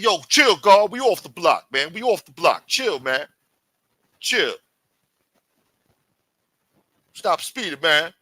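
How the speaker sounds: high, loud urging to calm down; urgent pleading. Retold or imitated. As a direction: tell the driver off in an imitated urgent voice.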